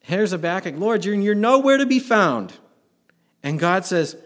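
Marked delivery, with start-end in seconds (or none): 0.8-2.5 higher and louder, calling out to God; pleading prophet. Acted. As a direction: cry out to a hidden Lord. Preacher voices Habakkuk's complaint.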